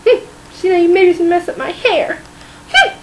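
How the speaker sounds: high, crying whine; whiny complaint. Acted. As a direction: whimper like a spoiled child. Whiny complaint about hair.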